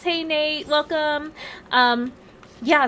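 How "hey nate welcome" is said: ordinary delivery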